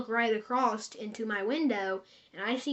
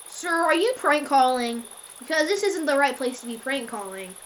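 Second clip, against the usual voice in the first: stern accusation; 4 semitones higher, 7 dB louder than usual. Retold or imitated. Worker accusing caller of pranking.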